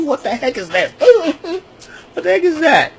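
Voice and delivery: high, laughing what-the-heck; mock his jokes through laughter; laughing mock disbelief. Mocking Solo Sikoa's bad joke, laughing disbelief.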